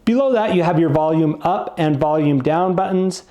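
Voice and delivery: usual speaking voice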